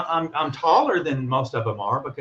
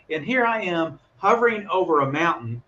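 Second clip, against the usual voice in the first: ordinary talk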